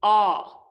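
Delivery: ah as the sound of O, not the letter; just the sound. Shown as a demonstration. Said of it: "just the sound, not the letter".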